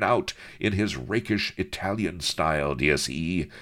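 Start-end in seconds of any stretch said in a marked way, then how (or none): none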